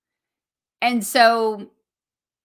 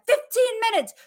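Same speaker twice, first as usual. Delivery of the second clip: much higher, urgent time call; excited; 13 semitones higher, 5 dB louder than usual. Retold or imitated. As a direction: call it out like a countdown. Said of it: "and I'd be like".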